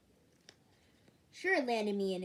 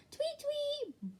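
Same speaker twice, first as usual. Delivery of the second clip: bird tweet. Acted. Imitating bird sound.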